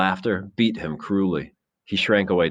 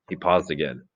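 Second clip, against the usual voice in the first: plain reading voice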